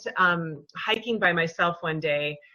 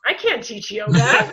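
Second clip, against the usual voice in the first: louder, anxious protest; panicked self-doubt; 3 semitones higher, 6 dB louder than usual. Retold or imitated. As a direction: blurt out the doubt. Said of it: "immediately following that was".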